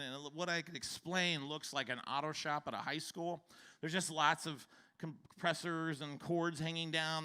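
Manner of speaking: ordinary talk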